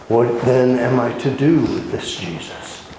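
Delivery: normal talking voice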